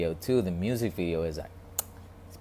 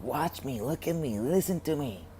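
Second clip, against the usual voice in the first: mock screaming voice; 8 semitones higher than usual. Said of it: "it's screaming at you".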